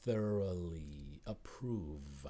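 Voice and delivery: "Thoroughly" drawn out slowly, tongue up for the th; slow model of "Thoroughly approve" with clear th; careful th demo. Shown as a demonstration. Teacher modelling "Thoroughly" for students, slow and careful. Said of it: "Watch how I say it".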